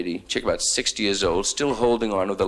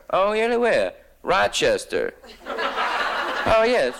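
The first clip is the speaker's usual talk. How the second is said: put-on gushing old couple; 10 semitones higher than usual.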